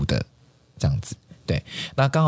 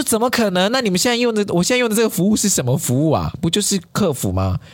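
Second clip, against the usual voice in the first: higher, a retold incredulous protest; 11 semitones higher than usual. Retelling her protest to the agent, higher and incredulous. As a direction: ask in mock disbelief.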